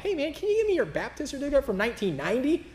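high, silly put-on caller voice; a hammed-up phone call asking for records; mock phone request. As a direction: play the absurd call for laughs. Mock phone call to old church.

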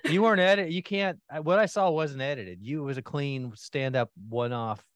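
ordinary talk, nothing marked

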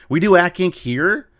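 imitating his own shock at the news; astonished question. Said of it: "And I go".

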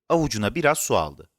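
plain reading voice

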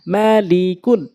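drawn-out syllable by syllable reading; slow demo. Shown as a demonstration. Teacher speaking so learners can follow, slowly. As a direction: stretch each syllable for learners. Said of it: "slow বলছি এই জন্য".

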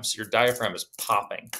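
ordinary delivery

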